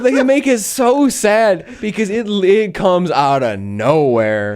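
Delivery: normal talking voice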